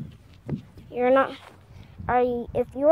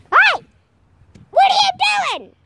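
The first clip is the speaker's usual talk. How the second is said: loud high yell; shouting; 8 dB louder than usual. Real, not acted. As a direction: call out sharply. Calling out at someone.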